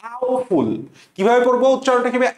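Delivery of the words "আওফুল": আওফুল said high and sharp, letter by letter as aw-ful; high spelling-aid আওফুল; spelling pronunciation. Shown as a demonstration. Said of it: "প্রশ্নের উপরে এভাবে লিখে রাখো".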